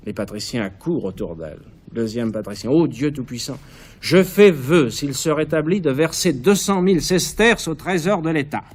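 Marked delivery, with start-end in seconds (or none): 2.7-8.6 loud, high, fervent prayer; fervent vow. Acted. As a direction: cry the vow out to the god. Patrician makes a fervent vow.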